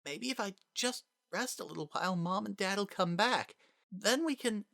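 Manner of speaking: put-on high child voice, hurried and soft; a little boy talking himself into hope; hopeful small child. Child hopes parents return.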